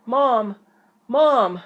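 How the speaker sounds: high, loud panicked call; screaming for mom. Acted. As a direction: yell for mom in fright. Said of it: "Jasper screamed".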